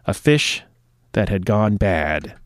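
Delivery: mistimed dramatic read. Shown as a demonstration. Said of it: "My timing's off".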